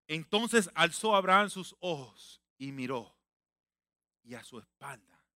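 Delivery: slow, solemn reading; scripture reading. Acted. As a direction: lean on each word. (lectura lenta y solemne)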